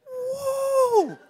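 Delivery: high, loud, laughing whoop of joy; jacked up whoop. Retold or imitated. Preacher showing how fired up he gets, whooping loudly. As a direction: let out a joyful woo. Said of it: "I can get so jacked up".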